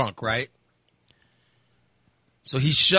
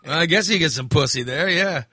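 ordinary delivery

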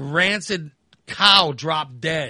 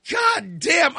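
shouted curse; 11 semitones higher than usual. Retold or imitated. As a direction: bellow it at the smell. Reacting to stench again.

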